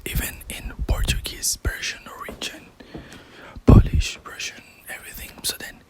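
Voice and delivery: hushed whisper throughout; library whisper. Real, not acted. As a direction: whisper so as not to disturb readers. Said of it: "I will start to speak a little bit quiet".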